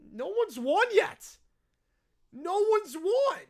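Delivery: WON stretched out slow and high; slow, emphatic, high-pitched correcting on WON; exasperated correction. Acted. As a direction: drag out "won" loudly in exasperation. Host exasperated, correcting the brat.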